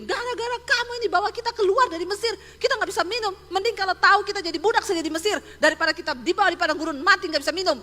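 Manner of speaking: loud, high, fast accusing complaint; angry people griping at their leader; grumbling crowd. Retold or imitated. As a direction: whine and blame Moses at full volume. Complaining Israelites berate Moses.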